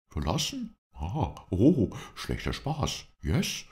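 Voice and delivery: high, startled character voice; slow, high-pitched disbelief; incredulous. A narrator's reading. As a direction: take it for a bad joke, drawing the words out. Lindsay disbelieves the sudden departure.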